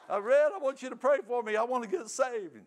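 loud, very high, hammed-up tearful begging; mock bawling plea. Drunk man begging his brother Red again, loud mock bawling. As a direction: wail it out like a bawling drunk, exaggerated. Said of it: "I'm a bawling".

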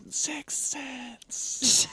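high, eerie pretend ghost; mock ghostly voice. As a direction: play a spooky voice from the closet. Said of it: "what is the voice saying".

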